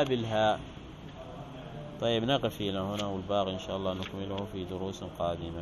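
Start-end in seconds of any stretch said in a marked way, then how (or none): 2.0-5.6 quiet hurried closing. Real, not acted. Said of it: "closing the lesson".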